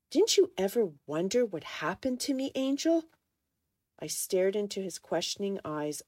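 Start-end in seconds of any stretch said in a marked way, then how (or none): 0.1-3.0 raised, accusing question; pleading demand for an answer; hurt challenge. A narrator's reading. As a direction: confront an old love, pitch climbing. Hurt man confronts former lover.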